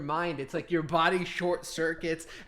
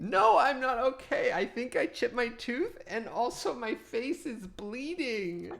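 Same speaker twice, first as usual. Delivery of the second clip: hurt complaint; 9 semitones higher than usual.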